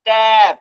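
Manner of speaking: "ট্যাপ" loud, as one held syllable; blended word. Shown as a demonstration. Teacher blending sounds into a word, loud drawn-out "ট্যাপ". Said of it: "ট্যাব পড়বে".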